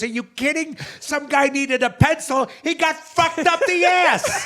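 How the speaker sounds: hammed-up incredulous holler; acted outraged yell. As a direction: shout it in mock disbelief, stretching the end. Sick passenger yells the punchline.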